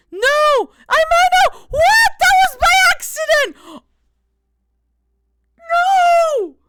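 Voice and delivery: real panic, shouted high; panicked shriek. Real, not acted. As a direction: shriek in real horror at your mistake. Accidentally timed out a viewer.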